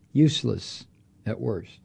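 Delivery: ordinary talk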